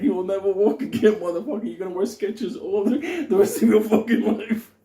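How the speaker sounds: high, loud hammed-up threat; mock menacing taunt. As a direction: shout it like an over-the-top torturer. Voicing menace at foot-beating victim.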